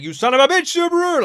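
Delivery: loud, high, charging yell; furious shout. Retold or imitated. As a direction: scream his name as he storms down the aisle. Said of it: "he's like".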